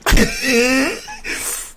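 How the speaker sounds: high cackling laugh; loud burst of laughter. Real, not acted. Watching the arrest clip, he bursts into loud laughter.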